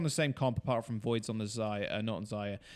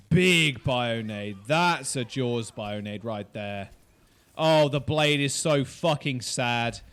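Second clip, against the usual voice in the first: loud, drawn-out play-by-play; emphatic game commentary; 5 dB louder, slower than usual. Real, not acted. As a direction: stretch each call out loudly and slowly. Commentator reacts to big game play.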